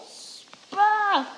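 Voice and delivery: ordinary talk, nothing marked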